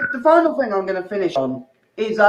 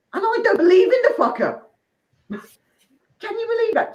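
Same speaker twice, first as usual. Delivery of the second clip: higher, disbelieving outrage; incredulous; 12 semitones higher, faster than usual. Real, not acted. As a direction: sell the irony, amazed. Incredulous punchline.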